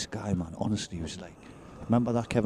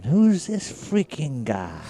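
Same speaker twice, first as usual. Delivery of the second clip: slow, higher mock American accent, stretched out; American accent impression; 6 semitones higher, 3 dB louder, much slower than usual. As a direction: drawl it like a Welshman faking an American. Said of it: "His American accent was mental".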